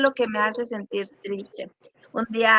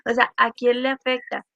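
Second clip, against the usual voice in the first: ordinary delivery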